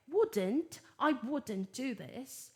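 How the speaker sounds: "wouldn't" British-style, T sounded, drawn out; slow British demo of "wouldn't"; British pronunciation. Shown as a demonstration. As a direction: say "wouldn't" the British way, slowly, with a clear T. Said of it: "Tô dando as pronúncias British e American".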